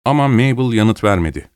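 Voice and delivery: ordinary delivery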